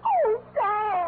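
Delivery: choked-up, pleading; tearful. Acted. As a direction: sob caught on his name. Secretary sobbing at his joke.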